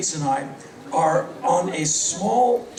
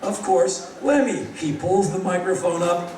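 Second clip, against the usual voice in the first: ordinary talk, nothing marked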